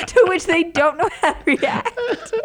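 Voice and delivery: high, loud and slow through laughter; laughing. Real, not acted. Telling co-hosts how old Italian men react, laughing. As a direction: laugh your way through the words. Laughing at her own story.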